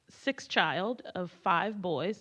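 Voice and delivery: ordinary talk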